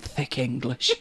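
low soft Thick English; scornful aside. Retold or imitated. Host's own verdict on tourists, low and soft. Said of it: "I look at them and think".

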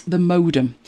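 usual speaking voice

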